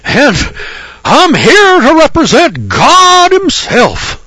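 loud, high, slow, self-important proclamation; pompous mock prophet. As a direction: ham up a grand prophet. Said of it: "Elijah doesn't say".

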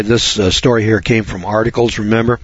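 usual speaking voice

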